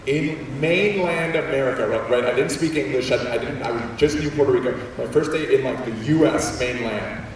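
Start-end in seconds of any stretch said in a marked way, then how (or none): none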